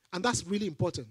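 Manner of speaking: ordinary delivery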